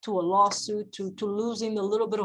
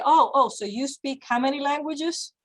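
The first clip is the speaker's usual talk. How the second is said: mock-innocent question, pitched up; lightly snarky comeback in a higher voice; a little snarky; 4 semitones higher than usual. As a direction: feign being impressed, with a sly dig. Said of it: "a little snarkily will say".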